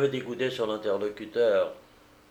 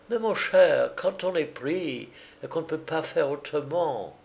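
high character voice, placating; raised pitch, smooth persuasion; condescending American; 5 semitones higher than usual. A narrator's reading. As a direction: talk him round, pitched up.

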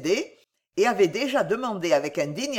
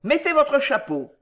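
higher, insistent command; acted impatience, pitched up; peremptory order; 7 semitones higher, 4 dB louder than usual. A narrator's reading. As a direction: order her sternly. Wardle orders his sister.

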